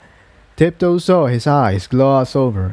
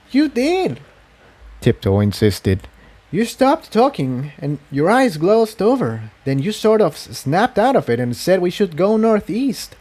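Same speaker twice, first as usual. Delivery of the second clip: put-on much higher young mare voice, firm; a pony insisting to her friend; high insistent mare voice; Tiptoe, insisting; 7 semitones higher than usual. A narrator's reading.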